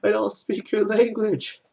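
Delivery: loud, high, drawn-out mock tourist; obnoxious American. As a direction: imitate an American talking slowly and loudly at a foreigner. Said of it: "this obnoxious American's going".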